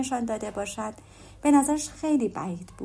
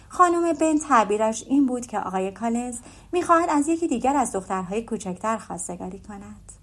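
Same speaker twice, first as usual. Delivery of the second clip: plain reading voice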